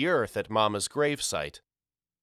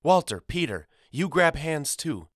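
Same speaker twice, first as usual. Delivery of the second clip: mother calling sons; 7 semitones higher than usual. Acted.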